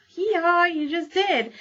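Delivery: high, loud hee-haw, then a gloating "you just did"; donkey bray and taunt. Retold or imitated. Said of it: "book characters' dialogue".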